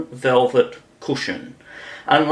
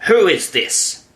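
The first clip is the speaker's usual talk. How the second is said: stern question, pitched up; severe; 6 semitones higher than usual. A narrator's reading. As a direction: demand it severely, higher. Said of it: "the Queen said severely".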